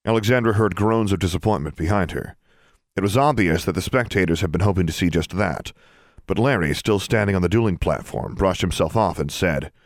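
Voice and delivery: ordinary delivery, nothing marked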